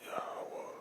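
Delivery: imitating a barely audible voice; faint whisper. Imitating his father faintly repeating the name, in a whisper. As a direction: breathe the word like a dying man. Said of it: "I mean, it's that faint".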